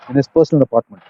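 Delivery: usual speaking voice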